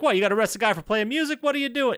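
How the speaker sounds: high, fast bad Brooklyn accent; hammy Brooklyn accent, quick and high. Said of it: "in the worst Brooklyn accent ever".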